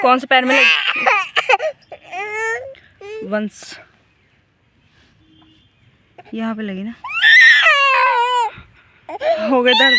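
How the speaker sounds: mother checking her son's injured foot, loudly; worried calling. Real, not acted. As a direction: rush to a crying son. Son hurt by hoe, crying.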